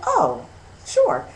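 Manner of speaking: very high-pitched, playing along; indulgent parent reply. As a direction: answer a child's secret in a high voice. Answering her child's secret.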